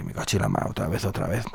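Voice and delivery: a hint of a gruff grumbling king; lightly voiced grumpy king; low king voice. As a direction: mutter it low like a sulky king. King voiced low in folk tale.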